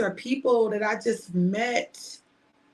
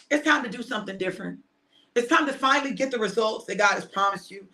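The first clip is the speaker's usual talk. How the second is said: rousing preaching; 5 semitones higher than usual. Acted. Rallying viewers toward their destiny.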